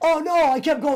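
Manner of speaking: loud, high, eager; bursting to tell it; excited. Real, not acted. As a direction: jump in to correct them. Excited protest.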